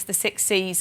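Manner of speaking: ordinary talk, nothing marked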